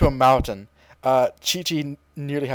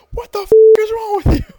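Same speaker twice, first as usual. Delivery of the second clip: high, loud, disbelieving shout; outraged yell; 8 dB louder than usual. Retold or imitated. Yamcha and Tien yelling at Goku, loud and high. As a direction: scream it at your friend's stupidity. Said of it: "Yamcha and Tien are like".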